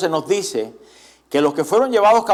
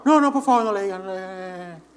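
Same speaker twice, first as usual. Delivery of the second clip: mock pleading child; 3 semitones higher, 3 dB quieter than usual. Children begging not to be told on.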